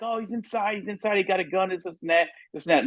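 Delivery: high, hurried female voice; frantic woman. Retold or imitated. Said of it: "She's like".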